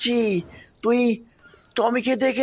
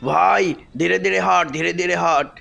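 low, menacing villain voice, gloating; low villain gloating over the bike; menacing Venom; 5 semitones lower than usual. Acted. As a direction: plant the bomb like a smug monster.

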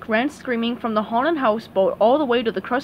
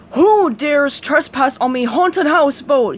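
louder pirate-captain bellow; 3 semitones higher, 7 dB louder than usual. Acted. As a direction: boom it from the deck. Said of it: "he bellowed".

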